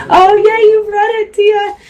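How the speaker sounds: light up as you spot a familiar name; excited recognition. Real, not acted. Vlogger recognizing a viewer in the comments, excited high burst.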